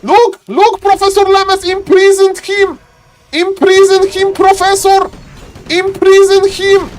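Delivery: high, frantic plea to the player; excited caster in a high, strained pitch; urgent excited calling. Real, not acted. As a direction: call out the move as if the game hangs on it.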